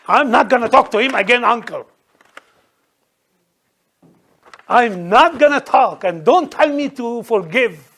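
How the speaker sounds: loud, offended refusal; acted indignation. Preacher mimics someone refusing to forgive an insult, loudly indignant. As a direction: refuse hotly, as if deeply insulted. Acting out an offended, unforgiving person.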